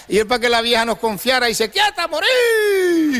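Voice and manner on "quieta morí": a much higher, slow drawn-out call to the goat. Acted. Novio calma a la cabra. (llamada aguda y lenta a la cabra)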